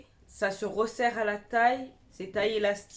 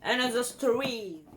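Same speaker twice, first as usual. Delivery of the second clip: put-on English accent. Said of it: "Vous avez vu l'accent?".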